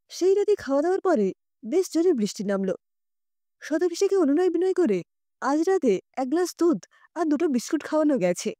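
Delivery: ordinary delivery, nothing marked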